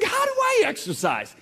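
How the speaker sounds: mock whiny plea; plaintive question. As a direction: whine it up to God. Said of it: "I said".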